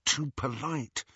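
softly whispered in an ear; whispering. A narrator's reading. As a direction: whisper it close to her ear. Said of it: "Harry whispered in Hermione's ear".

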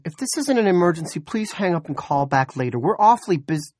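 high, loud, hurried woman's voice; flustered desk officer under pressure; harried dispatcher. A narrator's reading. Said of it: "She sounded harried".

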